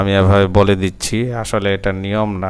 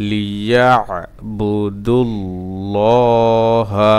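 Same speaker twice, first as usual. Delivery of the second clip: slow chant, syllables linked; joined recitation; slower than usual. Shown as a demonstration. Teacher putting the word back together, slow joined chant. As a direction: join it up with long held vowels.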